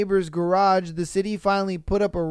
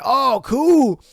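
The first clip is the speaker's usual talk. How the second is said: higher, louder, fast mock of a cheerful squatter; 6 semitones higher, 4 dB louder than usual. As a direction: play the oblivious homeless guy, loud and breezy. Streamer voices squatters waking up.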